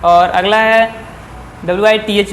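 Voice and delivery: ordinary talk, nothing marked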